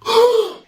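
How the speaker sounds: sharp, high gasp on waking; loud gasp of sudden panic; startled gasp. Acted. Narrator jolts awake late for school, startled gasp. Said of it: "eu acordo assim".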